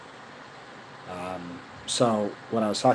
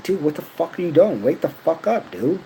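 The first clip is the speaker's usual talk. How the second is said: higher, louder mock yell at her; retold yell; 8 semitones higher, 6 dB louder than usual. Retold yelling at the ex.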